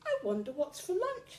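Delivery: high tiny fly voice; high buzzy fly; little fly. A narrator's reading. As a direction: muse it high and light. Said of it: "said the fly".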